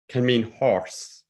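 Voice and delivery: plain reading voice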